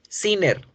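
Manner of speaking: "sinner" with a plain alveolar n; demonstrated pronunciation. Shown as a demonstration. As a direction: say "sinner" with a clear n, tongue tip on the ridge. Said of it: "Escuchen las dos".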